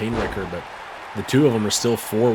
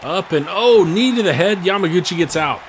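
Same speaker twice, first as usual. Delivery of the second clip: higher, louder sudden outburst; excited; 6 semitones higher, 4 dB louder than usual. Real, not acted. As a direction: jolt up as the knee lands. Sudden knee strike called.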